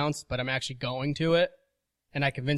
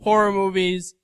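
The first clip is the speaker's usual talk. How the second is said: excited pitch; 5 semitones higher, 4 dB louder than usual. Retold or imitated. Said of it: "I was like".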